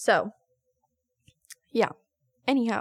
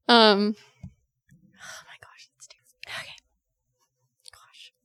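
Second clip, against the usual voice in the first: tearful, breathy whisper, very slow; real tears, barely getting words out; crying whisper; slower than usual. Real, not acted. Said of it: "I can't talk when I cry".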